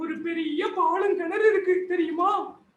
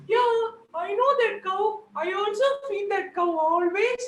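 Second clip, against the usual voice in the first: higher, lightly voiced granny; concerned granny; 7 semitones higher, much slower than usual. Granny asks the crying Joker, in a higher voice, what happened. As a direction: ask gently why he cries.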